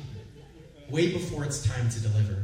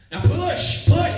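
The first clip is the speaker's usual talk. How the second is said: builds to a loud, high call of push; nurse coaching push; 13 semitones higher, 10 dB louder, slower than usual. Retold or imitated. Nurse urging Krista to push, loud call. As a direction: coach her, then shout push.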